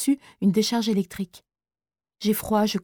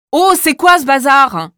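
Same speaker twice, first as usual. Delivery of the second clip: slow furious shout, much louder; teacher shouting as he storms in; angry shout; 5 semitones higher, 11 dB louder than usual. Acted. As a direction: bellow it at the boys from the doorway. Teacher bursts in.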